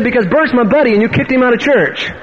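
high, put-on indignant voice; imitated angry accusation. Bert's buddy accusing the preacher, imitated anger.

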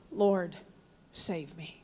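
soft, low retelling of a plea; hushed plea. Retold or imitated. Retelling the three words, hushed.

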